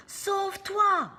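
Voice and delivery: high, loud, slow urgent plea; urgent cry. Acted. Scooby urges Sammy to run away from the ghosts. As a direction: urge him to run. Urging to flee.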